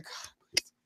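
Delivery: whispered desperate plea; whispered prayer. Retold or imitated. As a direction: breathe the prayer under your breath. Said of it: "And I said".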